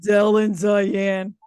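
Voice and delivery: put-on slow, drawn-out East L.A. accent; mom impression accent. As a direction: stretch the names in mom's East L.A. accent. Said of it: "I do this accent".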